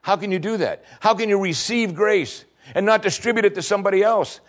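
high, driving rebuke; preaching at full force. Acted. Preacher pressing Jesus' rebuke on the congregation, high and driving. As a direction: press the question hard on the hearers.

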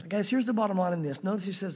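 ordinary talk, nothing marked

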